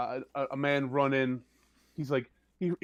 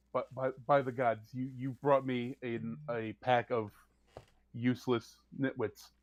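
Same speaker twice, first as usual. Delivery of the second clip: quieter, weary, lower exasperation; exasperated low mutter; 3 semitones lower, 6 dB quieter than usual. Acted. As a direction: sigh out your disappointment at them. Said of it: "she goes".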